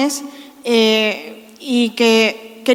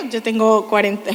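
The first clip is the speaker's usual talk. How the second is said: ordinary delivery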